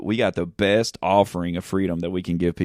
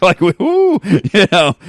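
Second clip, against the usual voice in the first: high, loud laughing "woo"; laughing whoop; 12 semitones higher, 9 dB louder, slower than usual. Real, not acted. To his co-host, laughing loud and high about Judas.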